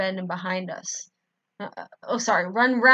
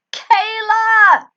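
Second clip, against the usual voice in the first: very high, loud squeal; relieved, frantic squeal; 14 semitones higher, 14 dB louder than usual. Acted. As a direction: squeal her name as loud as you can. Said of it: "I squealed loudly".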